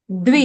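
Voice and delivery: द्वि as one quick syllable, d joined straight to v; crisp one-beat द्वि; correct dvi cluster. Shown as a demonstration. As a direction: model द्वि with no vowel between d and v. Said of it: "दवि नहीं".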